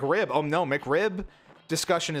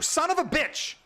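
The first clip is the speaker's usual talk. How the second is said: sudden high angry curse; real frustrated blurt at the game; angry outburst; 12 semitones higher than usual. Real, not acted. Curses at missed dunk.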